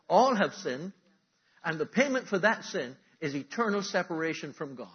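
loud, high-pitched preaching; full-force preaching. Acted. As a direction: proclaim the verdict to the whole hall.

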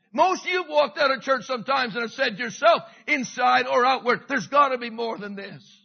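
loud, high, urgent preaching; fiery preaching. Acted. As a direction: drive it home to the room. Preaching at full force.

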